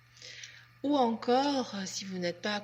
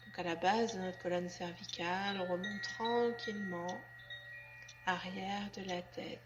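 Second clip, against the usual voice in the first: guided relaxation; 5 dB quieter than usual. Acted. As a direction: guide them gently and unhurriedly into letting go. (voix douce et lente de relaxation guidée)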